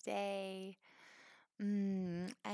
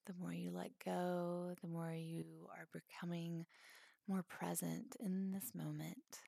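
calm, spacious phrases with long pauses; guided meditation; 4 dB quieter than usual. Acted. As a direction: gently guide them into letting go.

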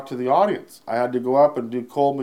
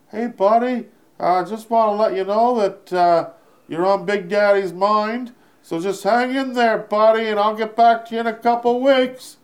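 high, louder put-on Harlan voice; Harlan impression; 12 semitones higher, 5 dB louder than usual. Harlan writing back to the comic, high buddy voice. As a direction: play the big showbiz buddy, pitched way up. Quoting Harlan's reply email.

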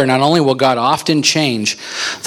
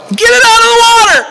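very high, full-force yell; panicked mock scream; 9 dB louder than usual. Boater screams to his friend as boat sinks, panicked yell. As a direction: scream it like the boat is going under.

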